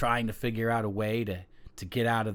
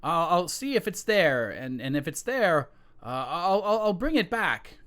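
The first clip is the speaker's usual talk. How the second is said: nervous son; 8 semitones higher than usual. Acted. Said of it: "the son's like".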